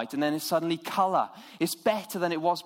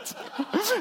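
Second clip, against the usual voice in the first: laugh. Real, not acted. Laughs at own slip.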